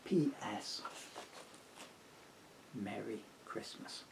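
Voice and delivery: plain reading voice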